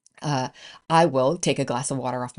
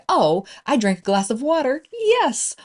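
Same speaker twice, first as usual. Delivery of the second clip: pleased discovery leaping to a high yes; mock celebration; 7 semitones higher than usual. Celebrating a glass of water, playfully triumphant. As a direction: build to a high-pitched victory cry.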